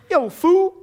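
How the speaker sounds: punchy imitated Southern street call; imitated Southern accent. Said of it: "Southern states, that's the way they talk".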